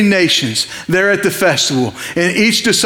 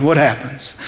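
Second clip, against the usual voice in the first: normal talking voice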